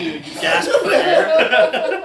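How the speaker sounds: high, loud, slow, laughing through the gasp. Retold or imitated. Retelling the snorkel panic, gasping through laughter. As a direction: act out the gasp while cracking up.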